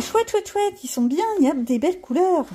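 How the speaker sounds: mock excitement. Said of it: "on se dit".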